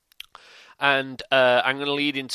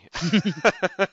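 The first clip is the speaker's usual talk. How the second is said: laughing; 8 semitones higher, 6 dB louder than usual. Real, not acted. Laughing at the long drive.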